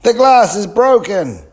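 man yelling over his broken greenhouse; yelled. A narrator's reading. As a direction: bellow it at the boys. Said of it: "yelled the man".

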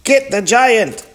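high, loud order to attack; storybook battle cry; urgent command. A narrator's reading. Page sets rats on giant.

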